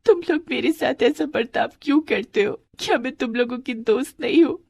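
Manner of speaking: put-on high, wounded little-girl voice; acted tearful appeal; hurt pleading. Hurt Radha pleads with classmates.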